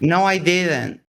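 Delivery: pouty denial. Real, not acted. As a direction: deny it with a slight pout. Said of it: "You sound like a little eight-year-old girl".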